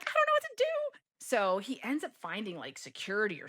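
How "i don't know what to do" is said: loud, very high mock wail; freaking out. Traveler to cleaner who rescued her, high mock wail. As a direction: wail helplessly in exaggerated panic. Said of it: "I'm freaking out".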